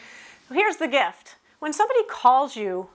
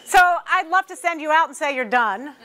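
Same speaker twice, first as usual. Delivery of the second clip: ordinary delivery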